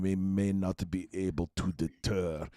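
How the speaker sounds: a lightly imitated movie threat; evil Eastern European accent. Directors coaching the actor, lightly mimicked in an evil Eastern European accent. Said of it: "a really evil Eastern European".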